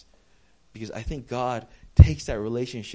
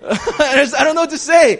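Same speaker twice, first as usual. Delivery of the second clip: loud, high-pitched laughing exclamation; flustered outburst; 13 semitones higher, 15 dB louder than usual. Retold or imitated. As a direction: burst out helplessly through a laugh. Flustered at friend's blunt answer.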